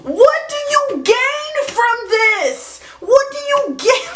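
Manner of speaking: slow, high, drawn-out disbelief; exasperated. Acted. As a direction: stretch it out like you truly can't believe it. Punchline repeated, drawn out, high.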